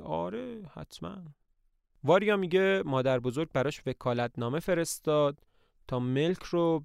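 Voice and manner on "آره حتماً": softer, dry mock agreement. A narrator's reading. Trofimov mocking Varya's hope, soft and sarcastic. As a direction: sneer it gently. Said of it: "با مسخره میگه".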